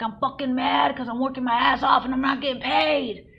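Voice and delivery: loud, high angry rant; re-enacted furious venting; screaming it out. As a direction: let the grievance rip, high and loud. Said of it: "I'm screaming it out".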